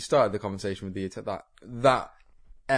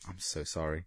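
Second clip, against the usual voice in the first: soft, low mock contrition; hushed, lowered sorry; mock apology; 6 semitones lower, 11 dB quieter than usual. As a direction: apologize quietly in pretend shame. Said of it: "us all saying".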